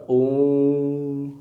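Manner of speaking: ओम said soft and drawn; prolonged om. Shown as a demonstration. Teacher showing the pluta vowel with ओम. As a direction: draw the ओम out three times longer. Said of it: "प्लुत बोले तो क्या होगा".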